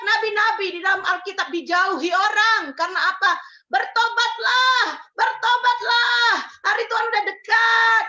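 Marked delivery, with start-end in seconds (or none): 3.7-8.0 slow, loud, high cry of warning; prophet's call to repent. Acted. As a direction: proclaim it like a prophet crying out, stretched and loud. Prophets calling people to repent.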